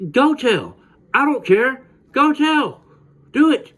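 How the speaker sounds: put-on defiant kid. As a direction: dare him to go tell. Defiant reply to bully.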